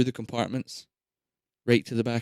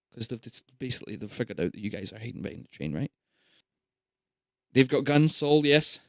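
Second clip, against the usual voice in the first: usual speaking voice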